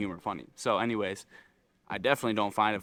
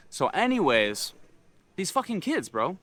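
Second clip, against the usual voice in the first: normal talking voice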